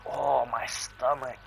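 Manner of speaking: slow pained moan, voice pulled away; queasy moan.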